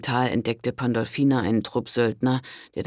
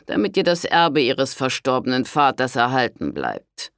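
pompous lord; 6 dB louder than usual. A narrator's reading. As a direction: announce the pope's will with authority. Lord soothes with false assurance. (selbstgefälliger Conte, feierlich)

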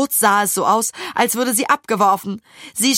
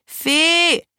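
high, drawn-out yell; bellowed order; 9 semitones higher, 3 dB louder, much slower than usual. A narrator's reading. Maja tells Fee to slow down, bellowed order. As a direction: yell insistently to be obeyed. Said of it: "brüllte Maja".

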